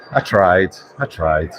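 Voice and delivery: ordinary talk, nothing marked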